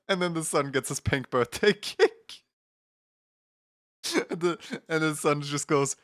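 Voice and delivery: talking through real laughter; can't hold the laugh back; giggling through the words. Real, not acted. Laughing while telling the punchline.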